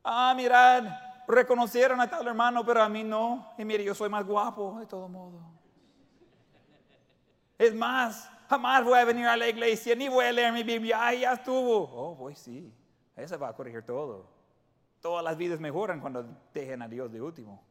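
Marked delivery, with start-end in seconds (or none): 0.0-11.8 loud, high, indignant complaint; offended grumbling. Retold or imitated. Slighted church member complaining, loud and indignant. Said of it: "y uno dice". 11.8-14.3 low, soft, dry mock agreement; deadpan the irony; sarcastic. Real, not acted. Preacher mocking the quitter, dry and sarcastic.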